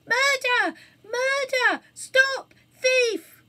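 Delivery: high, shrill, piercing scream of murder; thin screaming. Acted. Phoenix pretending to be a victim, shrill scream. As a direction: shriek it from the street outside. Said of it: "sharp voice screamed".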